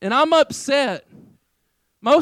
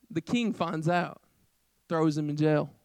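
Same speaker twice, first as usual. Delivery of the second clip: ordinary delivery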